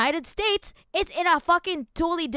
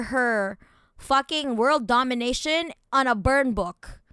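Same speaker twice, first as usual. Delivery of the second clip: ordinary talk, nothing marked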